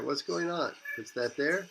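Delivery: ordinary talk, nothing marked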